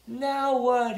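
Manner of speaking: high, loud helpless question; high storybook holler; calling out. A narrator's reading. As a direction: call out for the next step. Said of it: "he called from inside his shell".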